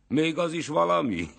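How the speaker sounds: high, loud mocking cry; shouted question. A narrator's reading. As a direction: call it out, scoffing at the others. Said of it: "kiáltotta".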